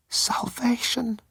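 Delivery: breathy high whisper; awed whisper. A narrator's reading. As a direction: breathe the word like a revelation. Narration whisper.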